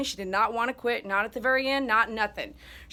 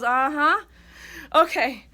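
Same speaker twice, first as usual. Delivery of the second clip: weak uh-huh; 2 semitones higher, 5 dB louder, slower than usual. Retold or imitated. Alice answering through drugs.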